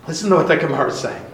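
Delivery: usual speaking voice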